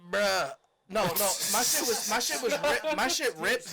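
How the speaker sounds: laut und aufgeregt beteuern; excited insistence. Real, not acted. Excited protest to friends.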